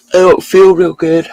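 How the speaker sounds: words stretched by a real yawn; yawning through it. Real, not acted. Streamer to chat, yawning after a long night. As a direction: say it mid-yawn, tired. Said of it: "Sorry, I'm yawning".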